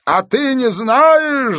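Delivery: slow, very high, loud taunting question; madman's yell. A narrator's reading. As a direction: howl it at the old man, drawn out. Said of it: "завопил Пельт". (протяжный вопль сумасшедшего)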